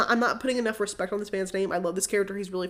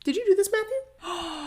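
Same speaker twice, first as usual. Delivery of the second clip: mock priest accusation; 9 semitones higher than usual. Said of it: "Father Young, literally was like".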